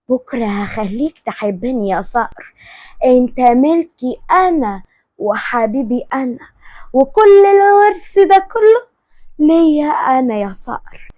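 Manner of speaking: slow, drawn-out gloating vow; acted villainess relishing her plan; possessive scheming.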